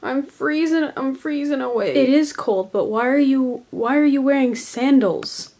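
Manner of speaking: high, loud freezing complaint; shivering. Pretend. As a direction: act frozen and shrill. Acted cold skit character.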